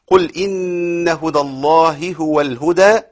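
slow drawn-out tajweed recital; Quran recitation. Shown as a demonstration. Teacher reciting the verse for the class. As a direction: recite with long held vowels.

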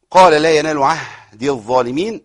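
very slow melodic tajweed chant; Quran recitation. Shown as a demonstration. Teacher chanting the verse to show the stops, slow recitation. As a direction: draw every vowel out in recitation.

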